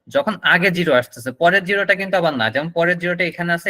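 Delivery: normal talking voice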